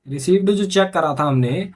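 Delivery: ordinary talk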